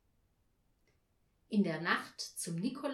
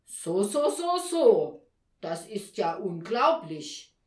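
loud tut-tutting that swoops up then drops; loud, incredulous Nikolaus; scandalised disapproval; 10 dB louder, slower than usual. A narrator's reading. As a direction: scold with weighty disbelief. Nikolaus disapproves of her failure.

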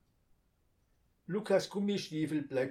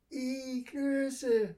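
put-on high, loud childish yuck; yelling in disgust; 13 semitones higher, 6 dB louder than usual. A narrator's reading. Little sister yells in disgust at the dumplings. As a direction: bellow out the yuck like a little girl. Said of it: "brüllt Lilli".

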